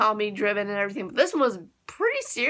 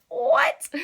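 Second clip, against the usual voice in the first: baffled what; 12 semitones higher than usual. Retold or imitated. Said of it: "I'm like".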